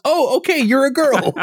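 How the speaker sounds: way up high and innocent, puzzled and cheerful; a wide-eyed kid figuring it out, pitched very high; childlike Goku voice. Retold or imitated. Podcast host voicing young Goku as he checks the girl, high and innocent.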